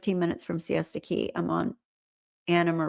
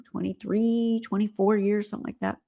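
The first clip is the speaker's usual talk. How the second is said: ordinary delivery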